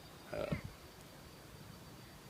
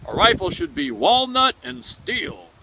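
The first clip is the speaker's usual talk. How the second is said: declaring; 12 semitones higher, 8 dB louder, slower than usual. Retold or imitated. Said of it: "I have said this myself".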